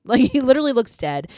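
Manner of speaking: can't keep a straight face, laughing; giggling as it comes out. Real, not acted. Laughing at the corpse look.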